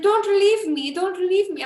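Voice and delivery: high, pleading Albanian accent imitation. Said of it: "I'm just trying to do his cute accent".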